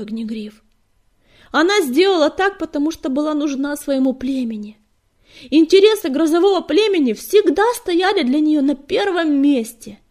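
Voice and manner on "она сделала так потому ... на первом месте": high, loud, insistent; impassioned defence. A narrator's reading. Fireheart defends leader before the clan, impassioned. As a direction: argue her case with fervour.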